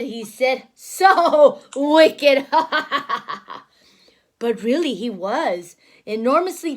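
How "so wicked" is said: loud laughing wicked wolf; gloating laugh. Acted. Wolf gloating over his meal, laughing. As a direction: relish being bad.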